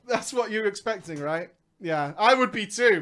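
usual speaking voice

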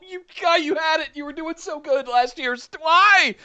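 high, strained protest to the wrestler; exasperated pleading. Retold or imitated. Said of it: "And I'm just going".

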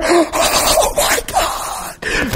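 imitated breathless gasp of awe; acted stunned, whispery drawn-out awe; undone. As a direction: gasp it out, barely voiced, overwhelmed. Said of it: "they're just undone".

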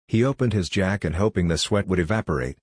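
ordinary delivery

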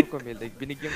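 soft, underpowered voice; mahinang boses. Real, not acted. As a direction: start explaining too softly. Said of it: "Mas malakas pa daw yung singhot kaysa". (mahina, kulang sa lakas)

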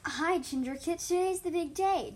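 high, loud, drawn-out cheer; excited greeting. A narrator's reading. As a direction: greet a kid on the big day. Friend greets excited kit on big day.

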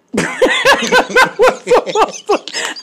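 very high laughing scream; loud mock holler through laughter; imitated holler. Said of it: "And she hollered".

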